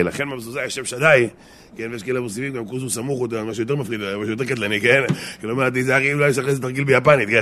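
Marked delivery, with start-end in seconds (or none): none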